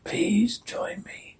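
distorted ghostly whisper. Acted. Daddy's warped voice luring Jane, whispered. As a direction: breathe it out, eerie and warped. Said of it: "Dad distorted voice".

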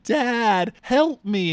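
a hint of a hurt girl calling dad; pleading daughter. Daughter shot, pleading to dad, mock pitiful.

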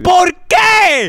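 high, loud indignant why; mock outrage. Angry at a donor for 0.99 instead of a sol, mock outrage. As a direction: demand it like you've been robbed.

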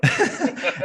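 high burst of laughter; hearty laugh. Real, not acted. Laughs at own joke.

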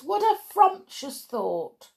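high, thrilled exclamation; delighted cry. Acted. Witches applauding the mouse plan, high delighted cry. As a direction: relish it in a high crowd voice. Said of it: "cried the witches".

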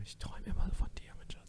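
creepy lover's whisper. Pretend. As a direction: breathe it obsessively close. (obsessives Flüstern zum Kumpel)

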